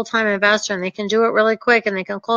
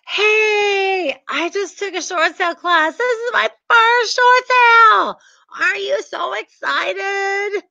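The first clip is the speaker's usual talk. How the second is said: high, bubbly newbie gushing; overeager beginner; 9 semitones higher, slower than usual. Retold or imitated. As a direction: gush like a clueless first-timer. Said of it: "some of you will be like".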